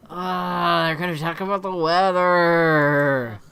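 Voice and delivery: loud, slow, drawn-out moan; whiny groan. Acted. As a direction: groan it out in exaggerated dread, stretching the words. Dad dreading small talk, whining.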